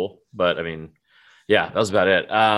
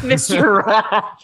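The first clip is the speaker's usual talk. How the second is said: laughing; 9 semitones higher, 5 dB louder, much slower than usual. Real, not acted. Laughing repeat of co-host's joke.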